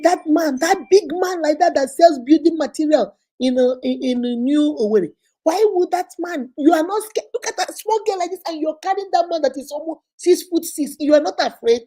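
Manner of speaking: high loud scolding disbelief; outraged auntie. Retold or imitated. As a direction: berate her, high and loud. Auntie shouting.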